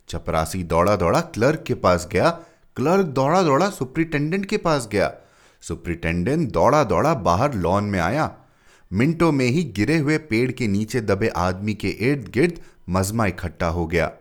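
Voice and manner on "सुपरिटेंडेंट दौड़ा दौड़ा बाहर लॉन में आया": usual speaking voice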